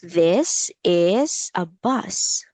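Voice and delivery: very slow, word by word for a learner; teacher's slow repeat-after-me delivery; slow model sentence. Shown as a demonstration. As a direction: draw out each word for repeating. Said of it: "Let's say it in a sentence".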